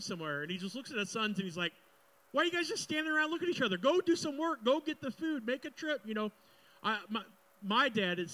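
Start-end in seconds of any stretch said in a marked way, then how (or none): 2.3-6.0 high, loud, fast dad scolding; rapid-fire dad rant, pitched up and loud; exasperated dad. Acted. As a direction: nag the idle sons like a fed-up dad. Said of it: "that is such a dad thing".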